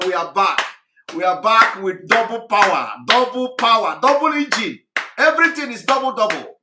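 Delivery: loud, pumped-up rallying shout; rallying cry. Real, not acted.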